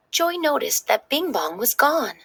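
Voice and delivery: ordinary delivery, nothing marked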